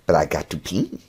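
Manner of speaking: ordinary talk, nothing marked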